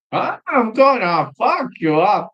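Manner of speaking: high, slow put-on tough guy voice; Strickland impression. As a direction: do a drawn-out menacing fighter voice. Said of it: "And he says".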